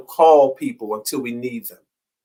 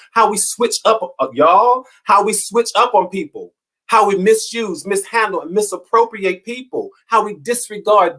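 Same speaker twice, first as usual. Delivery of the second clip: higher, faster preaching run; building preaching; 5 semitones higher than usual. Acted. Preacher listing how we mistreat people, rising fervor.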